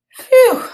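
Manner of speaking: high, loud sigh of relief; relieved phew. A narrator's reading. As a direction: blow out the relief. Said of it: "I thought".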